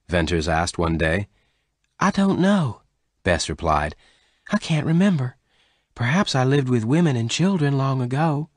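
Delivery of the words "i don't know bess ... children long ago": high-pitched character voice, searching memory; Bess, unsure. Acted. Bess answers Venters about her forgotten past, unsure.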